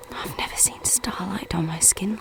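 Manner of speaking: hushed whisper of wonder; awed whisper. Acted. Naked woman under starlight, awed whisper. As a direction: breathe it as if the stars might hear.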